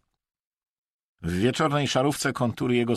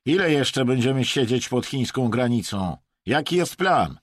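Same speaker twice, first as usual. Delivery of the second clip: raised, pressing questions; impatient; 5 semitones higher, 5 dB louder than usual. A narrator's reading. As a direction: demand a plan, fed up. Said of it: "zaapelował zniecierpliwiony Rosjanin". (zniecierpliwione, natarczywe pytania)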